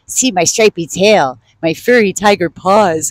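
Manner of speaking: ordinary delivery, nothing marked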